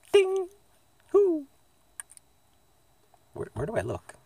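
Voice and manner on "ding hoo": very high "ding" and "hoo"; playful high sign-off noises. Pretend. Vlogger closing the update with imitated noises, high-pitched. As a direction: chime it high, then hoot.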